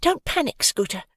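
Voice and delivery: calm a panicking friend; calm, confident. A narrator's reading. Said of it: "calm and as confident as possible".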